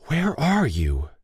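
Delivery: lightly voiced, demand to know why; Lay's hurt letter. Lay cries out to God.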